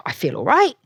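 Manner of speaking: rising, hopeful plea. Retold or imitated. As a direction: coax him into agreeing. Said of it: "I said to my husband".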